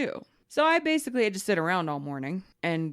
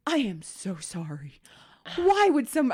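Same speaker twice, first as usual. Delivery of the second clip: imitating a mortified agent apologizing; apologetic; 4 semitones higher than usual. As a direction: drop the volume and apologize earnestly. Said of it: "he just kept apologizing".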